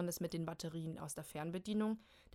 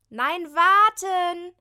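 very high, very loud, drawn-out shout; desperate shout; 11 semitones higher, 19 dB louder, much slower than usual. Acted. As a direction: yell to stop her. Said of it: "ruft Kim von unten". (verzweifelt laut rufen)

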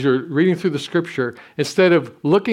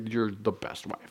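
tender, laughing; 6 semitones lower, 12 dB quieter than usual. Retold or imitated. Kisses wife, says tenderly.

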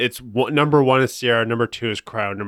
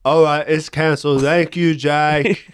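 higher, louder put-on voice, laughing at the end; co-host acts the agent cancelling, higher and louder; mimicking the phone agent; 6 semitones higher, 4 dB louder, slower than usual. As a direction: play the rep closing the call, breaking into a laugh. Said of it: "that's pretty good".

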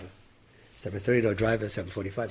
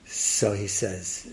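usual speaking voice

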